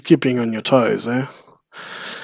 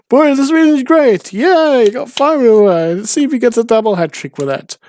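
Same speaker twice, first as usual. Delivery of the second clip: much higher and louder, hammed up; squeaky Steve voice; 10 semitones higher, 5 dB louder than usual. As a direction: crank the goofy old man up. Repeating Steve's catchphrase.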